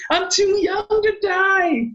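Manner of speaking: high, slow panicked cry; freaked-out scream. Acted. Joke's panicking plane passenger, high mock scream. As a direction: squeal it like a terrified passenger. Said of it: "freaks out and screams".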